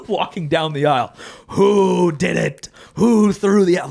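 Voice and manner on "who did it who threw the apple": terrifying teacher. Retold or imitated. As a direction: bellow it like a teacher hunting the culprit. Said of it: "Like, it was terrifying".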